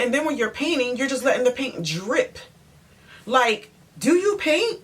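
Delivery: high, incredulous challenge; exasperated scolding. Retold or imitated. Acts out scolding the painter.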